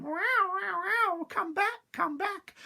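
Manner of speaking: high, loud yowl; yowling cat. Acted. Cat chasing the gingerbread man, high loud yowl. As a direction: yowl after him.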